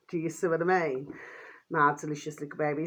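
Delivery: ordinary delivery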